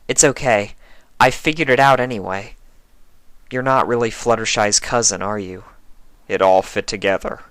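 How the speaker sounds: lightly voiced Twilight. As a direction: gently let him down.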